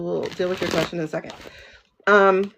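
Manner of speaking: ordinary delivery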